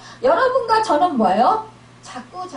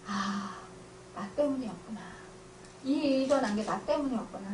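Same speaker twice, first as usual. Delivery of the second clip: hushed, dropping to almost nothing; quiet realization; 4 semitones lower, 11 dB quieter than usual. Acted. Believer quietly realizing fault in prayer, hushed. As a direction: murmur it as a dawning inner thought.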